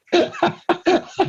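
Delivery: high burst of laughter at his own joke; big laugh. Real, not acted.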